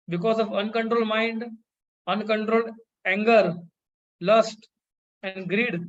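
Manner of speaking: each word drawn out very slowly; slow, weighty preacher's warning; very slow emphasis. Acted. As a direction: spell out every vice, slowly and deliberately. Emphatic listing of vices.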